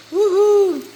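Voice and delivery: high delighted woohoo; excited whoop. Real, not acted. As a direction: shout for joy, way up high. Sausages look so good.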